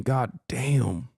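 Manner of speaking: ordinary talk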